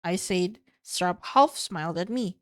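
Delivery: ordinary delivery, nothing marked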